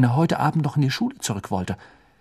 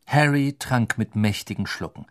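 plain reading voice

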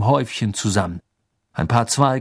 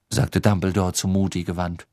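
usual speaking voice

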